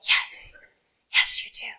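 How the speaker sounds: soft, whispered inner nudge; still small voice. Acted. Preacher voicing the spirit's little voice to the congregation, whispered. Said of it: "still, small voice in your spirit".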